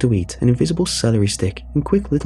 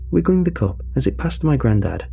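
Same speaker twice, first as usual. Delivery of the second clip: plain reading voice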